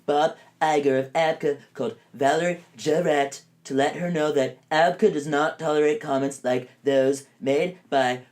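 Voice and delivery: a hint of Trump reading his own complaint; mock Trump tweet. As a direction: read the tweet like an aggrieved Trump. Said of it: "tweeted out".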